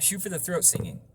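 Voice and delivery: ordinary talk, nothing marked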